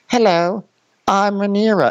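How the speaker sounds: high, refined mock British accent; young Rhaenyra's accent. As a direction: imitate a young British princess, pitched high. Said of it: "Your accent just changed regions".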